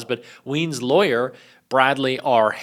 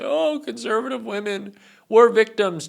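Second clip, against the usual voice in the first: mock moaning; 9 semitones higher than usual. Mimicking conservative women who bitch and moan, mock moaning. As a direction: whine it like a put-upon victim. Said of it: "bitch and moan and say".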